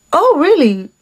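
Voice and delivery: high-pitched mock scepticism; mimicked disbelief. Date testing her claim, mimicked disbelief. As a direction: imitate someone doubting you, pitch swooping up. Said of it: "As if he didn't believe me".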